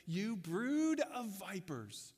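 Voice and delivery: slow, weighty rebuke of the priests; prophet's rebuke. Retold or imitated. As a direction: deliver it slowly, like a public condemnation. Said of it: "John says to them out loud in front of everybody".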